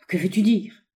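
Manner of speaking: put-on old man's startled question; startled cry. A narrator's reading. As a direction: exclaim with mild alarm. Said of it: "s'écria le vieillard". (exclamation inquiète du vieillard)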